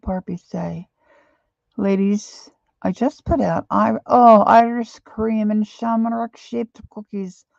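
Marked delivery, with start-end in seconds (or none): 1.8-7.5 a rough, slowed Irish lilt, louder and bit higher; bad Irish accent. Quoting Barbie to the chat in a shaky Irish accent. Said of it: "I have to work on my Irish accent. It's terrible".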